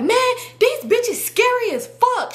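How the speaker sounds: high, drawn-out exasperated yell; retold screaming. Ranting to sister from the backseat, mock screaming. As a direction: re-enact screaming a complaint, stretching the words. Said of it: "I'm in the backseat screaming".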